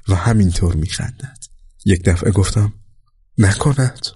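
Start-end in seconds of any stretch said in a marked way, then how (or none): 3.4-4.1 high startled exclamation; high, sudden gasp of realisation; sudden surprise. A narrator's reading. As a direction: blurt it out in shock. Sudden realisation husband is commander.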